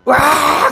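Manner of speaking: high mock shriek; imitated scream. Medium realizes her client is Saul, imitated shriek. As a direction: let out a sudden acted scream. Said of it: "소리 지르면서".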